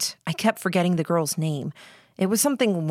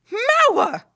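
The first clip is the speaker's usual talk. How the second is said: high shrieking cry of a name; 6 dB louder than usual. Acted. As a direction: shriek the name across the camp. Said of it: "Candy shrieked".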